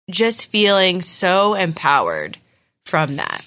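ordinary talk

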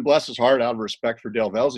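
ordinary talk

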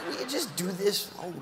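normal talking voice

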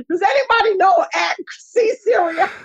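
high, laughing frantic question; laughing mock panic. Asking Twitter for a designer, laughing high-pitched panic. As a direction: crack up while asking in a panic.

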